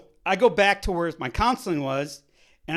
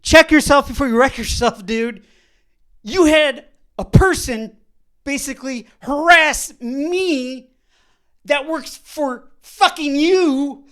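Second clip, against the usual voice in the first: loud, higher, slow scolding; heated comeback; 7 semitones higher, 11 dB louder, slower than usual. Retold or imitated. Retold rant at the clinic director, loud slow scolding. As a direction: lay into him deliberately.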